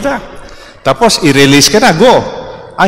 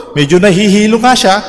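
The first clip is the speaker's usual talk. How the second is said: normal talking voice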